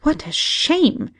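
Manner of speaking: high, slow scandalized gasp. A narrator's reading. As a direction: sound shocked and disapproving. Servant gossiping in shock.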